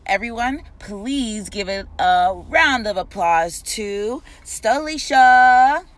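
loud, drawn-out hype-man announcer read; podcast host intro. Acted. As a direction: pump up the crowd like opening a show. Host hypes intro of show.

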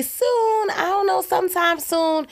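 high-pitched, noncommittal self-quote; evasive reply. Retold or imitated. Podcaster fobbing off a question about the next episode, high and evasive. Acts out dodging a listener's question.

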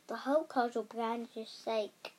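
ordinary delivery, nothing marked

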